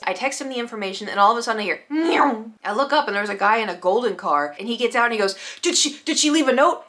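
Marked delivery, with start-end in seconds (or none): 1.9-2.4 high, loud zooming car noise; imitated car sound. 5.6-6.8 high, stammering, worked-up question; mock adrenaline rush. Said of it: "so hyped on adrenaline".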